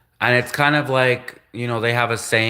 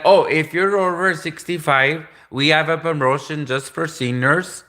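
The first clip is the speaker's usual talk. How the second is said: higher-pitched mock Indian sales caller; Indian accent; 5 semitones higher than usual. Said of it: "I can do an Indian".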